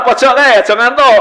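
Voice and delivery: tegur lebih tinggi dan keras; teguran keras. Retold or imitated. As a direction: panggil jamaah di pojok dengan lantang. Khotib menegur jamaah di pojok. (khotib menegur jamaah di pojok, tinggi dan keras)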